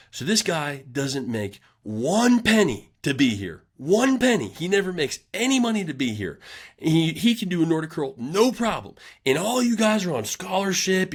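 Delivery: high, ranting mock coach; mimicking angry coach. Coach ranting at the team about the unpaid intern, high mock anger. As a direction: rant like the pissed-off coach, pitched high. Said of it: "And he goes".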